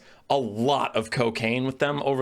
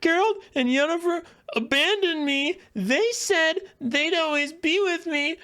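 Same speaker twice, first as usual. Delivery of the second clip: high, whiny mock sob of a teen; mock her whining in a high, nasal-free falsetto; mock whiny Ciri. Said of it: "bawling and whining about her parents".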